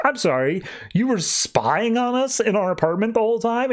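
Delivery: high, indignant question; mock indignant squeal; outraged disbelief. Spoiler outraged about spying.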